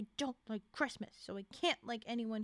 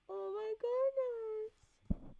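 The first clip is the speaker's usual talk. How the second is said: higher, very slow delighted oh my goodness; gushing slow squeal; drawn-out squeal; 8 semitones higher, much slower than usual. Real, not acted. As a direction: stretch every word in glee. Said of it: "Yes, I'm yelling into the book".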